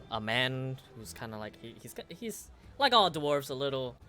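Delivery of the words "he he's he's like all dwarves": ordinary talk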